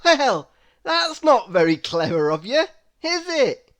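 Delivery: loud, mock-scolding tease rising at the end; Mister Happy teasing Mister Clever. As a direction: tut at him playfully.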